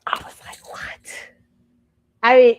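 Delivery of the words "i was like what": breathless whispered what; stunned whisper. Retold or imitated. Recalling her shock at the applause in a whisper. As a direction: whisper it in disbelief.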